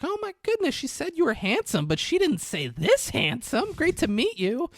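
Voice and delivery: very high-pitched, gushing mom voice. Retold or imitated. Mom greeting her daughter's handsome boyfriend, high gushing voice. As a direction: squeal it like a delighted mother. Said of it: "Mom's like".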